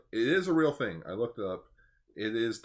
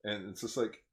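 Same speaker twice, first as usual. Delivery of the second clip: usual speaking voice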